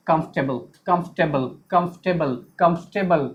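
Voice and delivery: कंफर्टेबल as "KUMF-tebl", low and even; correct pronunciation drill. Shown as a demonstration. Teacher drilling the class, low repeated "kumf-tebl". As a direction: repeat कंफर्टेबल squeezed, r silent. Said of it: "इसको क्या पढ़ेंगे".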